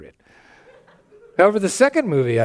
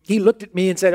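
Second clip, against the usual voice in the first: ordinary talk, nothing marked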